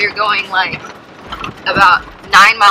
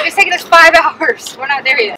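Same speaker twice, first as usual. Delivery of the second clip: usual speaking voice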